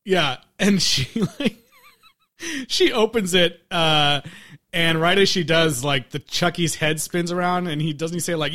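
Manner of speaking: ordinary talk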